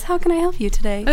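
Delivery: high, sweet-for-show helpful voice; fake customer-service politeness. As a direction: put on politeness for onlookers. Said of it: "if everyone's watching, it says".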